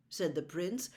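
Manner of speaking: usual speaking voice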